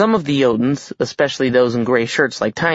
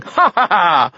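high, falling ha-ha-ha; shouted fake laugh; 6 semitones higher, 4 dB louder, slower than usual. A narrator's reading. Giant king pretending they are joking, forced laugh. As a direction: force out a hearty stage laugh. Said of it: "shouted the Giant King".

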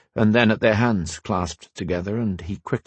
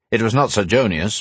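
ordinary delivery

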